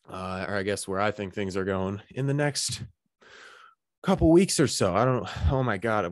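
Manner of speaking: breathless, gasping between phrases; out of breath. Real, not acted. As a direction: talk while still out of breath. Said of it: "why am I so out of breath".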